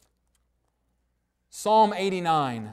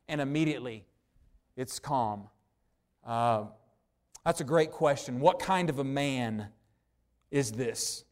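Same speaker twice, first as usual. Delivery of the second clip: normal talking voice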